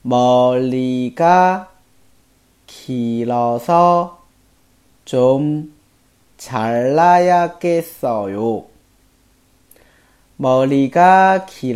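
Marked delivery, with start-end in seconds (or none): 0.0-8.6 slow, word-by-word Korean; slow, clear teacher reading; slow model reading. Shown as a demonstration. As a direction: read each word apart, slowly. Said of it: "今天的每日一句".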